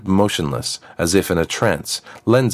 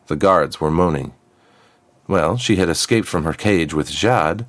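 usual speaking voice

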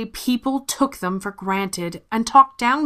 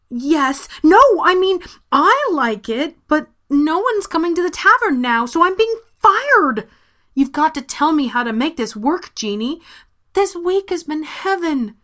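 high, breathless and rattled; flustered; 8 semitones higher, 4 dB louder than usual. Acted. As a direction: spill it out in a nervous rush. Flustered Alma pleads with genie.

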